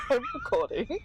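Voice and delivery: high, giggling words; laughing. Real, not acted. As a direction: squeeze it out between giggles. Giggles between broken words.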